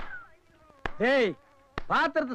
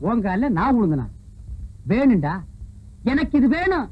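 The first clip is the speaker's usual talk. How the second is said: put-on loud drunken retort; 4 semitones lower, 3 dB louder than usual. Drunk answers loudly.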